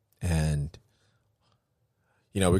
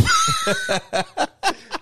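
high burst of laughter. Real, not acted. Host laughing at his own story, high burst. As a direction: let out a high cackle at yourself.

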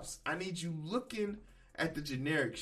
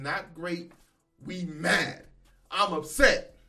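mock upset; 7 dB louder, slower than usual. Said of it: "we gonna be in here saying".

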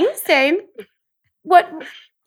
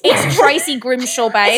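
Tracy Grimshaw impression; 3 semitones lower, 5 dB louder, slower than usual. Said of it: "pretend to be someone from Channel nine".

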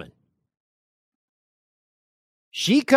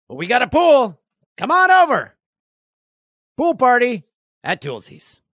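much higher and louder, calling out; excited invitation; 8 semitones higher, 7 dB louder than usual. Real, not acted. Host inviting listeners to the pool party, excited call.

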